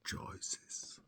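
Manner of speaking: a private whispered echo; whispered aside. Real, not acted. Repeating his own point to himself, a wry whisper. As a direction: breathe the word out, voiceless.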